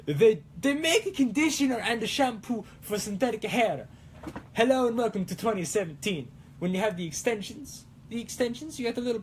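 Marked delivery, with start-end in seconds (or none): 0.1-3.9 put-on lifted, sing-song Italian accent; playful Italian accent, pitched up. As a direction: toss it off in a hammed-up Italian accent. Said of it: "I really just want to be like".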